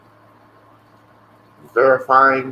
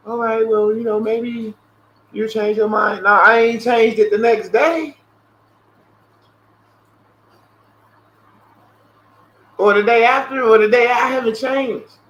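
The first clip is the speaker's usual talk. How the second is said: high, slightly loud mock phone voice; mock salesperson; 9 semitones higher, 5 dB louder than usual. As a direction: play both sides of the call in a raised, half-mocking voice. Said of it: "retold phone exchange".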